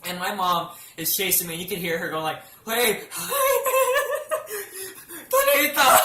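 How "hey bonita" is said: imitating mom calling out while cracking up; high, louder call broken by laughter; mom laughing. Mom chasing the dog, laughing while calling Bonita. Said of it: "she's just laughing her ass off".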